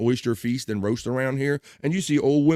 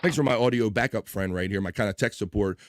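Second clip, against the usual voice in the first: ordinary delivery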